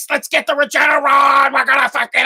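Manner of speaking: hammed-up, straining hero yell; He-Man style rallying cry. Fan demands Regeneron like He-Man, straining yell. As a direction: build toward a mock battle cry. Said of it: "like He-Man".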